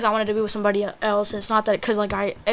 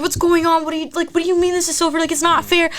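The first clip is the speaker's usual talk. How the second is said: high, urgent retold protest; breathless panic; 8 semitones higher, 4 dB louder than usual. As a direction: reenact pleading for an explanation. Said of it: "I could not breathe".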